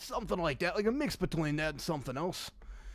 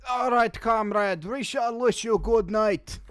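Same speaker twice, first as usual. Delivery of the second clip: put-on Russian accent; 4 semitones higher, 4 dB louder than usual. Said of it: "Russian accents".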